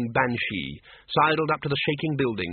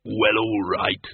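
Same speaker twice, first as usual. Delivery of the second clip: slow, low monster voice giving in; reluctant bogeyman; 3 semitones lower, slower than usual. A narrator's reading. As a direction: drag it out grudgingly as a bogeyman. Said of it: "he said, reluctantly".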